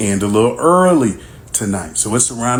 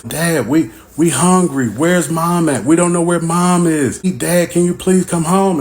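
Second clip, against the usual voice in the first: put-on higher, pleading kid voice; kids on the phone; 6 semitones higher than usual. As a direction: play the hungry kids calling dad. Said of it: "Then I start getting calls from the kids".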